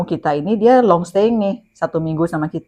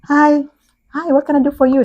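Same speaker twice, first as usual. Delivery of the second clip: higher, bright-faced hello, smiling through the words; smiling greeting; 9 semitones higher than usual. Retold or imitated. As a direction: manager greeting the annoyed guest with a smile. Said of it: "Saya udah senyum dong".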